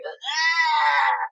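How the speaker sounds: long high-pitched creature wail; sloth cry impression. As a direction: imitate a slow, sustained sloth screech. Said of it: "The sloth's like".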